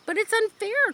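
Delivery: high, loud protest; a girl crying out to her father about injustice. Acted. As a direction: wail it as a plea. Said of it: "cried Fern".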